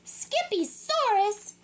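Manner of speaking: high, surprised cry of the name; mama repeats it in disbelief; surprised exclaim. A narrator's reading. Mama walks in on her son, surprised.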